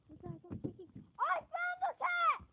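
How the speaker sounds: gasp, then high, thrilled burst; bursting with excitement over the cat; too excited. Real, not acted. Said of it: "Sorry, guys, I'm too excited".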